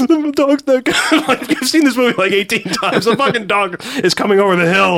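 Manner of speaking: high teary mom voice, laughing; imitating mom. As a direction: mimic a weepy mom, laughing. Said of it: "that's my mom".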